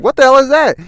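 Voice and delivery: very high, loud shriek of a line; loud high-pitched yell; screaming. Acted. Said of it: "He's screaming".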